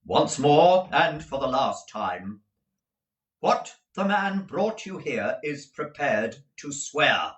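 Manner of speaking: loud, slow stern demand; final warning, hard. A narrator's reading. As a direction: Jaggers giving last warning. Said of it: "very sternly".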